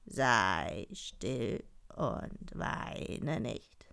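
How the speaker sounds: very slow, low, hushed frog voice; low, slow frog croak-talk; slow kindly frog. Acted. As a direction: draw the words out like a frog. Frog figure in the tale.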